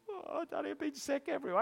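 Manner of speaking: put-on little girl voice. Sick little daughter calling to her dad, high and pitiful. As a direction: whimper it like a small sick girl. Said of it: "The poor little girl says".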